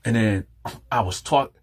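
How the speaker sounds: plain reading voice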